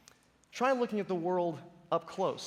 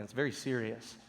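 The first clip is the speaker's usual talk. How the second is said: ordinary talk, nothing marked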